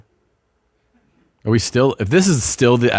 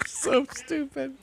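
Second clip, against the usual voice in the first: laughing; 6 dB quieter, much slower than usual. Real, not acted. Laughing at the prank.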